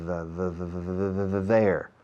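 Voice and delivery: "the" repeated, tongue between teeth, then "there"; exaggerated th drill; drilling the th sound. Shown as a demonstration. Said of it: "Pronunciación".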